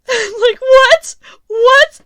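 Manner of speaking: high squeaky what, laughing hard; cracking up in disbelief; shrieking with laughter at a cheesy movie line. Real, not acted.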